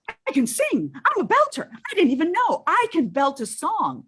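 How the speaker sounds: high-pitched, hammed-up amazement at oneself; thrilled discovery. Actress reliving winning the part, mock thrilled and high. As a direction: belt it out, astonished at your own voice. Acts out discovering she could belt.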